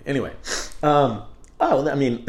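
usual speaking voice

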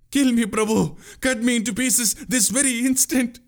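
very high, wailing plea; anguished shriek. A narrator's reading. Boatman begging the prince to kill him, shrieking. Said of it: "The boatman shrieked in anguish".

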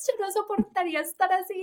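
high-pitched, quick mock disbelief; retold incredulous partner. Partner to her after therapy, retold high-pitched incredulity. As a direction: imitate a baffled partner, pitch lifted high. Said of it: "él me decía".

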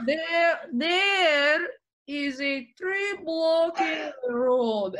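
put-on high, slow Russian accent; Russian driver stretching out each word. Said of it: "can you still do a Russian accent".

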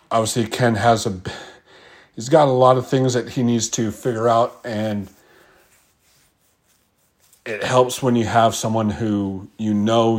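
ordinary talk

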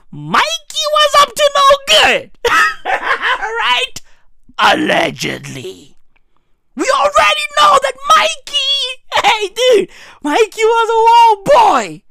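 high, loud squealing gossip; excited shout. Real, not acted. Comedian shrieks about [name]'s brother. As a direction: screech it like juicy gossip.

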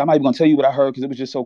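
hurried nervous babble on the phone; rushed and fast. Retold or imitated. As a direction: rush the words out nervously to your aunt. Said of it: "I'm talking real fast".

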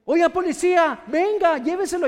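high, insistent summons; mock calling out. Parent calling police to take the child, mock calling out. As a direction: hail an officer, play-acted. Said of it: "Ya estás hablando con el policía".

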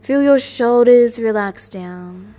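usual speaking voice

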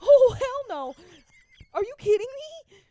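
high, very loud disbelief; panicked outburst. Real, not acted. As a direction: recoil loudly in horrified disbelief. Panic at a flying bug.

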